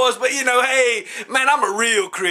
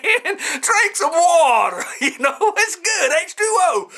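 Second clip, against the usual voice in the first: laughing; 7 semitones higher, slower than usual. Real, not acted. Laughing at his own advice.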